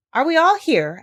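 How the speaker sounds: high, loud eager question; doctor's voice. A narrator's reading. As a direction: call out to the group. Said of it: "asked the doctor".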